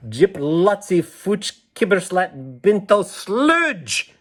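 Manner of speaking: high, slow made-up secret language; sing-song nonsense words read out as the girl; gibberish code talk. Acted. Girl reads her secret code to the listeners, high and slow. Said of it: "she's saying, code that only I understand".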